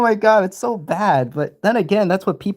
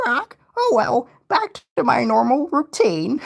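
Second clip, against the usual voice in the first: Potty the Parrot; 11 semitones higher than usual. Retold or imitated. Parrot sighing back to its routine in a high squawk. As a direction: drag it out like a cartoon parrot. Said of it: "Can you do an impression of Patchy and Potty?".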